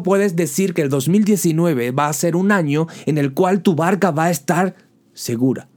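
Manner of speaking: usual speaking voice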